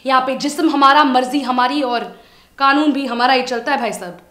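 loud, forceful tirade; ringing, insistent proclamation of ownership; defiant declaration. Acted. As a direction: lay down the law at full volume. Defiantly declares her house her country.

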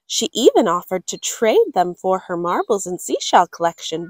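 ordinary delivery, nothing marked

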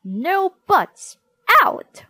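high, loud angry dismissal; stern, shouted teacher's order; glaring teacher. A narrator's reading. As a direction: cut off the pupil's protest loudly. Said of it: "she glared".

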